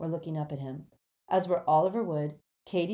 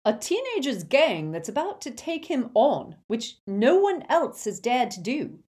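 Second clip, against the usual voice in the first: put-on teenager's gang turned back, loud; pointed retort; 7 semitones higher, 7 dB louder than usual. A narrator's reading. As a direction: throw her words back at her. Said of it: "said Fred".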